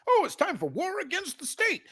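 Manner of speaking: very high mock quote. Mocking war talkers to chat, high sneering voice. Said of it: "mocking war talkers".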